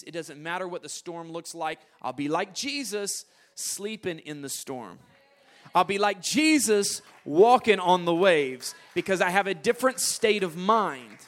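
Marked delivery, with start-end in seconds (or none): 5.7-11.2 rising preaching. Acted. Preacher building in intensity.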